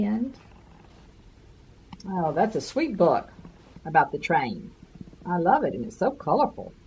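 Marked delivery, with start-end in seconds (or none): none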